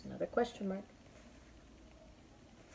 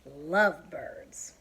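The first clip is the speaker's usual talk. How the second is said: Lovebirds said loud and slow with a falling end; exclaimed; 2 semitones lower, 6 dB louder, much slower than usual. Shown as a demonstration. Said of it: "with the exclamation point there".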